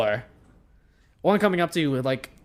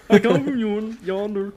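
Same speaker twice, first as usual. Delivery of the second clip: mock Goofy announcing where he hails from; cartoon dog voice; 6 semitones higher, 6 dB louder than usual. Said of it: "have the same accent as Goofy".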